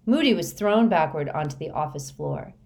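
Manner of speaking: ordinary delivery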